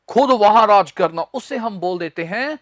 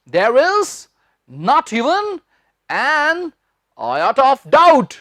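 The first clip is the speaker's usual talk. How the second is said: slow dictation; 3 semitones higher, much slower than usual. Shown as a demonstration. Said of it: "मैं बाद में लिखवाऊंगा".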